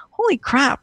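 sudden high gasp of alarm; a startled exclamation; shocked realization. Retold or imitated. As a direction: jolt up as it dawns on you. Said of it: "but I'm like".

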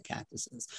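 "cactuses" breathed out as a soft unvoiced whisper; whispered aside. Real, not acted. Guest muses on plants, whispering the word "cactuses". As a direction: whisper "cactuses" to yourself, barely audible.

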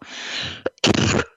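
sudden dry coughing fit. Real, not acted. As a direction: cough hard, off mic. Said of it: "I'm so sorry about this coughing".

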